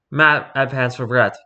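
ordinary delivery

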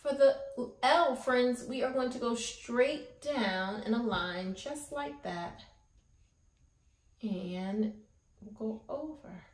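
usual speaking voice

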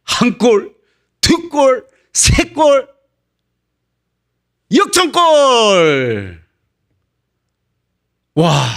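high goal shouts climbing to a long drawn-out cry; mounting triumphant yells; excited goal calls. Acted. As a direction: call each goal like a thrilled commentator. Acting out the miracle comeback goals.

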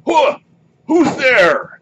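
startled high, loud monster shout; Ettin head calling out. Acted. Game master as an Ettin head spotting intruders, high loud shout. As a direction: yell a suspicious challenge, high and loud. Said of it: "You hear one of the voices say".

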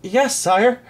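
high, eager reply to a king; lifted, obliging voice; greatly relieved. A narrator's reading. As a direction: answer with quick relief. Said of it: "feeling greatly relieved".

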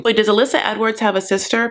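usual speaking voice

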